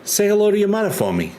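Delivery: high, fast Boston accent impression; Marky impression. Streamer mimics Marky sending regards to a mother, Boston accent. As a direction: rattle it off high, imitating a Boston actor. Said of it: "uh, Marky".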